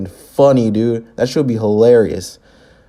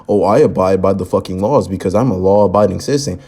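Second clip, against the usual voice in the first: lower, prissy mock of a law-abiding citizen; mock self-righteous; 6 semitones lower, faster than usual. Reactor mocks Greg's law talk, lower prissy mock. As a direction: sneer it like a smug rule-follower. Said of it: "Aren't you Mister".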